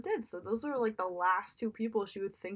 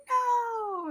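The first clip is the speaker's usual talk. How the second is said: grito alto e agudo de Simba; screaming no; 11 semitones higher, 5 dB louder than usual. Retold or imitated. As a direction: grite o não como um filhote desesperado. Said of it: "and then Simba screaming".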